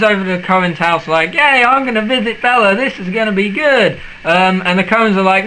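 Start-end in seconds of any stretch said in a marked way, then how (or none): 1.3-4.0 higher-pitched, naively eager cheer; hammed-up keen visitor; mock excitement. Jacob setting off to visit Bella, mock excited.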